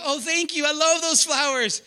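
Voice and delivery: mock-sweet thanks for the flowers; pleased wife. Pastor acting the delighted wife.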